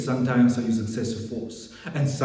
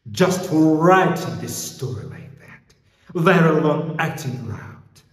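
loud, raised indignation; angry outburst; 5 semitones higher, 7 dB louder than usual. Acted. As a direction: hit it hard and high. Policeman rages about the story.

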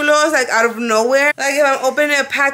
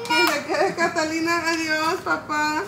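soft goodbye; 5 semitones higher, 6 dB quieter than usual. Real, not acted. Tender goodbye to son.

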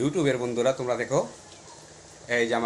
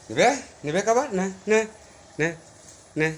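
playful high teasing offer, louder; teasing pets; 7 semitones higher, 5 dB louder than usual. Real, not acted. Owner teasing her cats with food, playful and high. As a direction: dangle the food and tease them.

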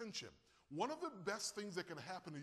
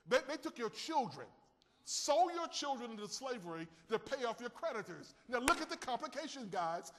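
raised preaching voice, higher and louder; 5 semitones higher, 4 dB louder than usual. Acted. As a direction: preach the widow's words out with force. Preaching climax.

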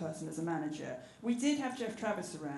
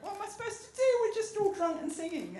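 high panicky; 14 semitones higher, 5 dB louder than usual. Retold or imitated. Karaoke panic, inner voice.

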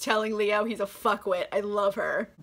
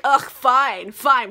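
loud exasperated groan of giving in; mock huffy concession, punched out; grudging surrender; 2 semitones higher, 7 dB louder, much slower than usual. Angry king grudgingly gives in.

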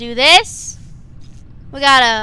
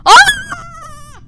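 shrill piercing shriek, much higher and louder; pterodactyl shriek; 9 dB louder than usual. Real, not acted. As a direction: screech like a pterodactyl. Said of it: "I scream like a pterodactyl".

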